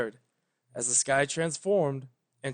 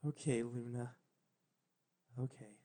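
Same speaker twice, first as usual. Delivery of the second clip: weak sigh; 4 semitones lower, 14 dB quieter than usual. A narrator's reading. Twilight gives in to Luna, very soft and weak. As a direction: barely breathe the words out, giving in. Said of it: "Weakly, Twilight sighed".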